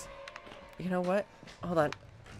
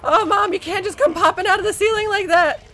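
high, loud rattled complaint; real fright spilling into protest; alarmed scolding; 11 semitones higher, 6 dB louder than usual. Real, not acted. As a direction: scold in a startled, raised voice. Monster pops out of ceiling.

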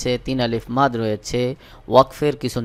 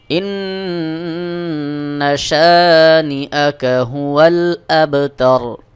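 slow, drawn-out tajweed chant; ধীর তিলাওয়াত; slow Quran recitation; 4 dB louder, much slower than usual. Shown as a demonstration. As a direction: recite each word long and measured. Recites the verse.

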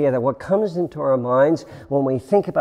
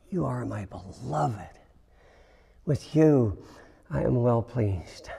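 tender Father; 3 semitones lower, 6 dB quieter than usual. Retold or imitated. Father speaking tenderly to son.

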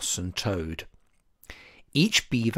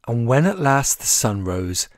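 ordinary delivery, nothing marked

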